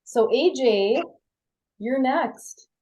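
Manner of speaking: normal talking voice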